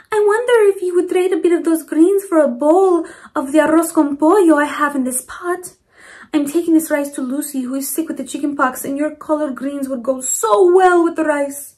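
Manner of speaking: lightly voiced grandmotherly neighbor asking; Mama Provi voice. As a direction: voice the kind old lady asking politely. Mama Provi asks for trade.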